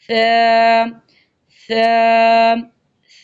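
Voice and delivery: ث with tongue tip between the teeth, no hiss; soft tha. Shown as a demonstration. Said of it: "دونوں دانتوں کے درمیان زبان کی نوک". (ث نرمی سے، سیٹی کے بغیر)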